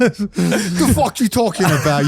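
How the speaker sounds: slow, drawn-out Boston tough guy; Boston accent. Pretend. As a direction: play a Thai man with a Boston accent. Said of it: "A Thai man with a thick Bos".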